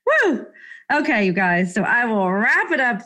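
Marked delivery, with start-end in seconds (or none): 0.1-0.5 excited woo. Real, not acted. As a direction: let out a big whoop. Excited cheer.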